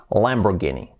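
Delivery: Lamborghini with a hard g, "-GEE-nee"; English pronunciation. Shown as a demonstration. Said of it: "pero en inglés sería así".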